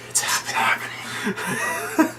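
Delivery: slow, drawn-out voiceless whisper; hushed, ominous mock whisper. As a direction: whisper it slowly, like a creepy aside. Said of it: "over there kinda whispering".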